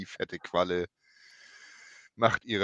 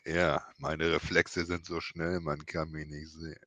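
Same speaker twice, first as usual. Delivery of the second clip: low and slow, deadpan; a hint of a deep deadpan hero; deep slow Batista voice; 5 semitones lower, 6 dB quieter than usual. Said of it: "Rhea Ripley fragt".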